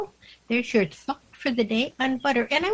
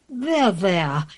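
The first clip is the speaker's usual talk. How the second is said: lower, falling comfort; lightly voiced royal reassurance, pitched down; soothing queen; 6 semitones lower than usual. A narrator's reading. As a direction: console like a storybook queen. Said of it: "The Queen said".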